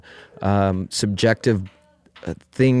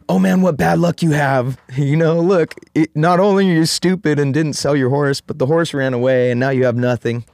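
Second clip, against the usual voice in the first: higher, louder jeering villagers; mocking villagers; 6 semitones higher, 4 dB louder than usual. Villagers scolding the farmer, high and jeering. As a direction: scoff at him like nosy neighbours.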